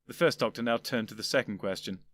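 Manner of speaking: ordinary delivery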